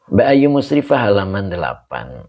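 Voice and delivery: ordinary talk, nothing marked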